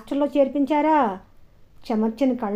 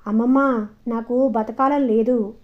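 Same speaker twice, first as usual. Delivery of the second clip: ordinary delivery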